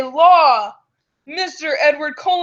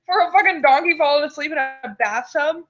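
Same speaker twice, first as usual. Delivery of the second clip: ordinary talk, nothing marked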